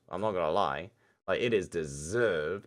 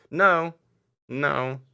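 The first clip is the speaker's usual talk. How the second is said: loud protest; 8 semitones higher, 9 dB louder than usual. Real, not acted. Objecting loudly.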